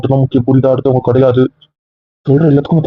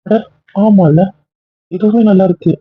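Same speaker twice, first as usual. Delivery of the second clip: mock delight; 8 semitones higher than usual. Husband mockingly agrees to her accusation.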